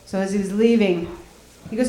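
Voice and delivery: ordinary talk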